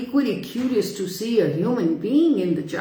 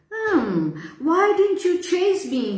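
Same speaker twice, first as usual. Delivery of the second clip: high timid question; 10 semitones higher than usual. Acted. Boy timidly asks the lion why it didn't chase him. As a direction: ask meekly, afraid of the beast. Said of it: "he asked timidly".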